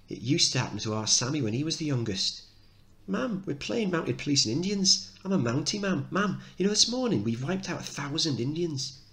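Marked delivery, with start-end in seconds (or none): 3.1-9.0 put-on high little boy calling his mum; a kid glad to see his mother; child calling out to his mum; excited young boy. Mickey voiced as child.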